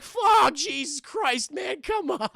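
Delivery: high, pleading cartoon whine; hammed-up exasperated pleading, pitched high; Shaggy. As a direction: beg like a scared goofy sidekick. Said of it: "Shaggy's like".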